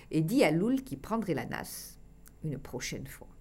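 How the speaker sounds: ordinary delivery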